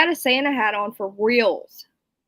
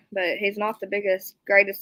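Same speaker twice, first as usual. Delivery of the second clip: ordinary delivery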